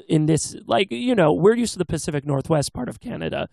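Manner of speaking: ordinary talk, nothing marked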